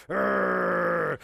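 long, steady held growl; drawn-out mock dog growl; dog growl imitation. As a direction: growl like an old dog at a wall. Imitates old dog growling at wall.

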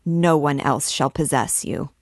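ordinary delivery, nothing marked

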